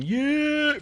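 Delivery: very high, loud drawn-out holler; mock an annoying hype man's squeal; Dawkins on the mic. Host mocking wrestler who grabs a mic, piercing holler. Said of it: "grabs a mic and starts going".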